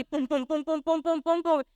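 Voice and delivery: rapid repeated "pum" heartbeat noises; imitated pounding heartbeat. Her hand on the woman's racing heart, imitated thumping. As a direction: mimic a heart thumping hard in the chest. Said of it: "ese corazón parecía que se quería salir. Estaba así".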